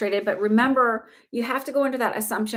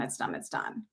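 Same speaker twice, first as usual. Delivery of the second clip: whispered; 13 semitones lower, 11 dB quieter than usual. Real, not acted. Sealing the manifestation ritual.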